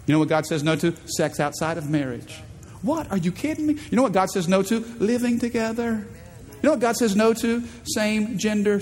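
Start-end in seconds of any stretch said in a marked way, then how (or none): 2.8-3.7 mock disbelief. Listener reacting to God's no.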